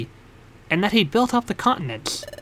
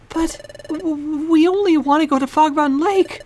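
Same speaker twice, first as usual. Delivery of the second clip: nervous hero voice; 8 semitones higher than usual. Acted.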